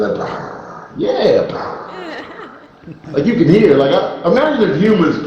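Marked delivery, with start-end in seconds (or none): 0.2-0.9 soft voiceless rolled purr; a quiet happy motor of a purr; imitated cat purr. Shown as a demonstration. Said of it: "It's like a happy sound". 1.5-1.9 soft voiceless purring trill; contented cat motor noise; imitated cat purr. Shown as a demonstration. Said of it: "It's like a happy sound".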